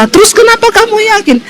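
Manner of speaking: high, fast question with a cry in it; tearful disbelief. Retold or imitated. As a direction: ask it near tears, fast. Suami heran, suara menangis. (suami bertanya dengan suara hampir menangis)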